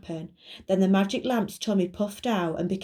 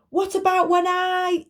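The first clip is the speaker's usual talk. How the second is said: high, louder muffled call; 11 semitones higher, 6 dB louder than usual. Acted. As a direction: speak as if from under heavy cloth. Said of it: "came Buster's muffled voice".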